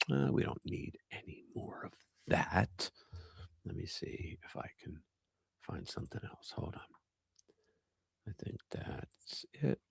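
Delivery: quiet muttering off-mic. Real, not acted. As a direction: mumble to yourself, away from the mic.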